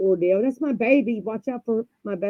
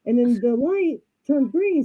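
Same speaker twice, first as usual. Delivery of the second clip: plain reading voice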